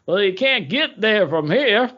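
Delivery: put-on a slow, high-pitched old-timer's voice; old local man. Old porch man tells the lost city boy, slow and high. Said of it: "That's what he says".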